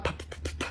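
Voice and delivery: soft voiceless popping puffs; imitated engine sputter. Nitrous runs out on the wheelchair, soft imitated sputtering. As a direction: sputter out with little lip pops. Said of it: "the Nas sputters out".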